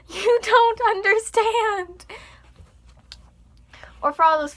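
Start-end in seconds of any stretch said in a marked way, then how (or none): 0.1-2.1 high, slow dramatic whine; mock lament. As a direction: stretch it out like a tragic artist. Dramatic wail after laugh.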